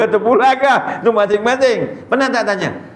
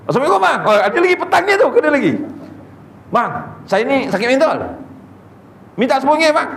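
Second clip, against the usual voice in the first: much higher mock beggar voice, laughing; wheedling beggar; 9 semitones higher, much faster than usual. Beggar on a motorbike greets the ustaz, high wheedling mock voice. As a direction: sweet-talk the ustaz with a laugh. Second beggar at petrol station.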